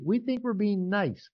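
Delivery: normal talking voice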